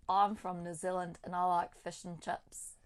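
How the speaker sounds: Kiwi accent demo with uh vowels; closed-off New Zealand vowels. Shown as a demonstration. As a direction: say it as a New Zealander, 'fish' as fush. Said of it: "do one, one phrase of both so I get it".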